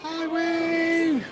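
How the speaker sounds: high, sustained sing-out greeting; calling out hello. Real, not acted. Host hailing Wayne, high called-out greeting. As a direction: call a long high hello across the park.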